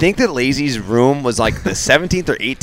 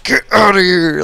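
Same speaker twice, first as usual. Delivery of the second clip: higher, louder, slow command; retold order; 9 semitones higher, 6 dB louder than usual. Fighter ordering the attacker to leave, loud. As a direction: bark him out the door, juiced up.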